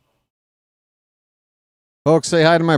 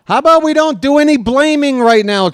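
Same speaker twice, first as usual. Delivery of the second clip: exasperated plea; 11 semitones higher, 4 dB louder, faster than usual. Real, not acted. Exasperated at chat blaming players.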